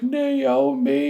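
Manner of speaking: high, drawn-out mock wail; mock anguished wail. Otacon calling after Naomi, hammed-up wail. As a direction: wail the name like a melodrama hero.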